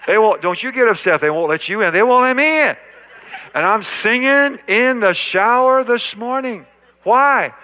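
loud, high, fervent preaching; preaching at full force. Acted. Pastor joking then preaching to the crowd, fervent. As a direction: drive it home with fire.

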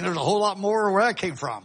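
ordinary talk

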